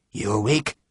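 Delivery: soft, hushed question; quiet voice at night; whispered. Acted. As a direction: whisper in the dark stable. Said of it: "Harper whispered".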